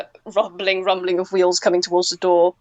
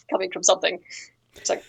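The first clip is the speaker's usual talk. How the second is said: ordinary talk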